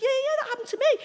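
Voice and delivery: very high, excited mimicry; imitated eager agreement. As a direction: agree eagerly in a squeaky pitch. Retold eager agreement by the woman.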